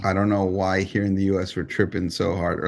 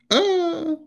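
mock dying gasp. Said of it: "she was like".

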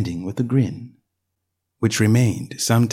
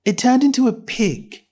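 put-on young girl voice; 12 semitones higher than usual. A narrator's reading. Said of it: "Alice quietly said".

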